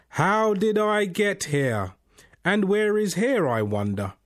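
high, loud wondering aloud; bewildered self-questioning. A narrator's reading. Man alone on a cliff asks himself aloud, bewildered. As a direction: ask it high, drawn out at first, dropping on the last words. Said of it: "said out loud, but really to himself".

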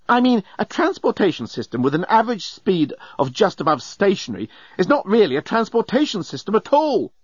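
high-pitched exasperated rant; raving. A narrator's reading. Journalist complaining in a standstill jam, raving. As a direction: rave on indignantly about the absurdity. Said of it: "The journalist was raving by now".

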